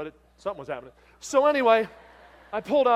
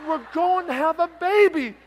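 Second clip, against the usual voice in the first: high, loud, slowed and beaming; the husband, thrilled, announcing the baby at three in the morning; excited; 10 semitones higher, 11 dB louder than usual. Retold or imitated. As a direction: announce the baby with wide-eyed joy.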